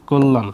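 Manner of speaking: loud, whole-word model of কল্যাণ as "kollan"; pronunciation demo. Shown as a demonstration. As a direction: give কল্যাণ a rounded o and doubled l. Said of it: "এর উচ্চারণ হবে কি".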